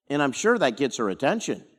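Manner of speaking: ordinary talk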